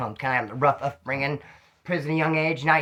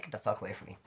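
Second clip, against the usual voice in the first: muttered thought; 7 semitones lower, 16 dB quieter, faster than usual. Retold or imitated.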